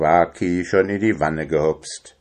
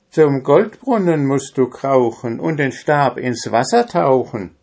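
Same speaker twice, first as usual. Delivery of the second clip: lightly voiced animal, higher lilt; rhyming animal messenger; 5 semitones higher, 3 dB louder than usual. Animal messenger instructs the dwarf, higher rhyming voice. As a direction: recite the instruction a little high. Story animal repeats rhymed advice.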